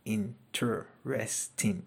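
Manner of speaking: interesting drawn out slowly, syllable by syllable; teacher modelling interesting slowly. Shown as a demonstration. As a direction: stretch interesting so every syllable counts. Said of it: "They're more than two syllables".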